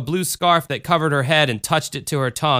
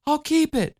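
put-on high childlike chatter; little girl voice; 13 semitones higher than usual. A narrator's reading. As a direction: speak up like a small girl. Michelle speaks.

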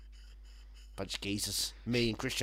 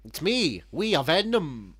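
Welsh Tom Hardy; 5 semitones higher, 6 dB louder, slower than usual. Retold or imitated. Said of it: "Welsh Tom Hardy".

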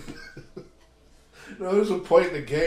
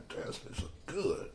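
much softer, whispered aside to himself; under-breath remark; 2 semitones higher, 11 dB quieter than usual. Retold or imitated. Watching the girl enter the dugout, whispered aside. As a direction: keep it secret under your breath. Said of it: "I was like".

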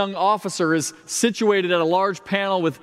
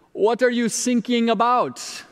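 slow, higher mock German accent on sinking; 5 semitones higher than usual. German trainee answers the sinking ship, in a slow mock German accent. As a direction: deliver the punchline in a put-on German accent, drawn out. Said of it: "the German Coast Guard trainee leans in and says".

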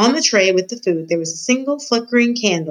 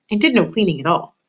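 ordinary delivery, nothing marked

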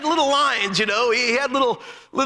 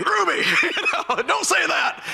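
laughing reproach; 6 semitones higher than usual. Retold or imitated. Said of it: "and Carl Hatch said".

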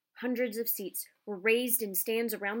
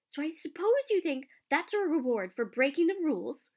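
higher, louder indignant scolding; angry girl scolding the boys on the stairs; 7 semitones higher, 5 dB louder than usual. Acted. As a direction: tell them off sharply from behind. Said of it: "came an angry voice".

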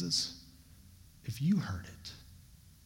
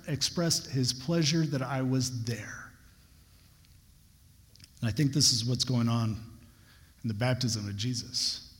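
usual speaking voice

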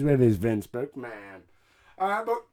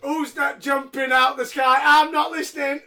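mock child shout; 8 semitones higher, 12 dB louder than usual. Voicing child at ringside.

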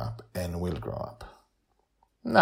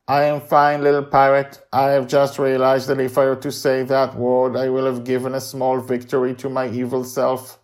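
loud, even, raised robot monotone; robotic Mastermind; 6 semitones higher, 7 dB louder than usual. A narrator's reading. Robot reassures little pirate about its evil self, in monotone. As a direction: recite evenly with machine-like steadiness.

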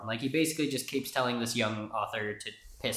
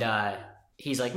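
ordinary delivery

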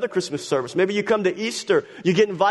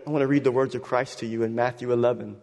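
normal talking voice

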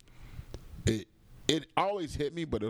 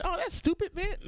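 Chris Tucker impression. Retelling Chris Tucker's reaction.

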